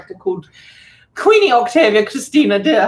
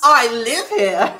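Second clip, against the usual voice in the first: slow, emphatic mock comeback; mock retort; 3 semitones higher, slower than usual. Soon-to-be citizen answers critics telling her to leave, mock retort. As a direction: draw it out as a pointed retort. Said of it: "turn around and go".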